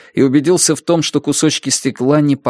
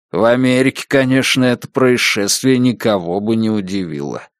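usual speaking voice

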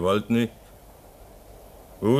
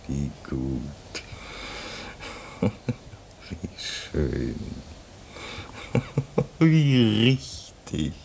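soft, breathy laughs between lines; bitter laughter; 4 semitones lower, 5 dB quieter, much slower than usual. Acted. Stranded man laughs at the endless polar day, bitter breathy laughter. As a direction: laugh it off, half unhinged.

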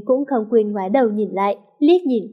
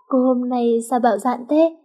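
usual speaking voice

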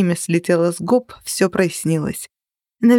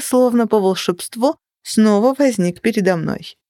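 ordinary delivery, nothing marked

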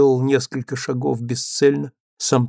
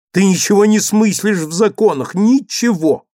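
higher, louder scolding; raised voice; 8 semitones higher, 8 dB louder than usual. Acted. Husband scolding his wife for not knowing the law, loud and high. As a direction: throw it at her, high and loud.

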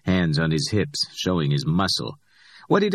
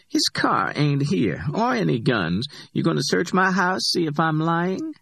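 higher-pitched, defiant Simone; Simone's voice, defiant; 10 semitones higher than usual. A narrator's reading. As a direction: lift the voice up for Simone and push back.

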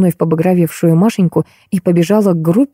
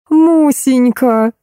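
high, long-drawn call of greeting; gushing aunt; 7 semitones higher, much slower than usual. A narrator's reading. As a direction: stretch out the pet name, pitched up. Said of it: "замахала руками дама". (протяжный высокий приветственный оклик)

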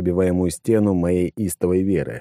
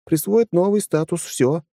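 higher, lighter woman's voice; female character voice; 10 semitones higher than usual. A narrator's reading. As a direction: voice the lieutenant.

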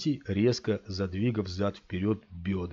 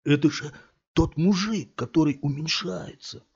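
excited discovery; 7 semitones higher, 3 dB louder than usual. A narrator's reading. Thug discovers shrinking man.

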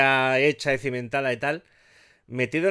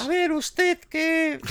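high, slow squeaky judge voice; thin reedy voice; much slower than usual. Retold or imitated. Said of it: "entre afónico y voz de pito".

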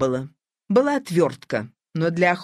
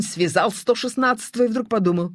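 usual speaking voice

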